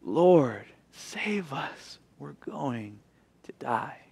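barely voiced plea of drowning men; hushed desperate prayer; desperate hushed cry. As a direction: breathe it out like men about to drown. That's the cry.

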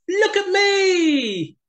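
high, slow, loud brag; boasting Hare shout. Acted. Hare boasting to the animals, high loud shout. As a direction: crow about being fastest. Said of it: "boasted Hare".

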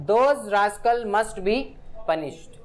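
very slow, each word set apart; slow example read. Shown as a demonstration. Teacher reading an example sentence to the class, very slow.

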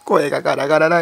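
real hoarse, strained throat. Real, not acted. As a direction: push through a sore, raspy throat. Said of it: "声ガラガラだ".

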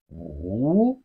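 "wu" dipping very low, then swooping up; falling-rising tone. Shown as a demonstration. Teacher models Chinese five for learners, dipping-rising "wu". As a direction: draw out "wu" from a low dip to a rise. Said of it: "It has the falling rising tone".

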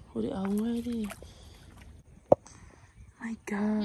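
whispered. Real, not acted. Quiet shocked reaction.

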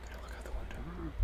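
fast scared whisper. Real, not acted. Gamer hiding from a monster, fast whisper. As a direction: breathe it out, afraid to be heard.

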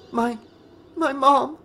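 high, halting tearful voice; voice breaking. A narrator's reading. Tearful Tina tries to tell Nick, voice breaking. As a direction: stammer it out high, voice cracking. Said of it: "her voice breaking".

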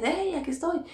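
high call to be spotted; imitated attention-getting call; retold calling out. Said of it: "yo hacía señas".

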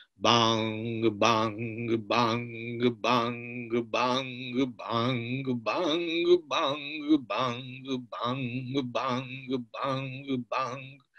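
low, hollow imitated clock strikes; clock chimes. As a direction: toll like an old clock. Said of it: "scena celor treisprezece bătăi de orologiu".

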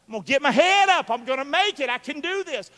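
high, loud mock pep talk to yourself; determined self-talk. Pastor acting out a willpower pep talk, high and loud. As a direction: psych yourself up.

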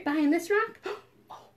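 ordinary delivery, nothing marked

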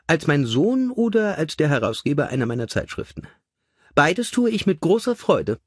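lady's voice, high and sweetly brisk; high voice for Lady Magna. A narrator's reading. Mother asking her son how to treat him, high and sweet. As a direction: play the genteel mother, lilting high.

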